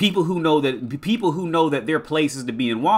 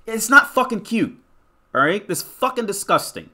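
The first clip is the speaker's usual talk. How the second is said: ordinary talk, nothing marked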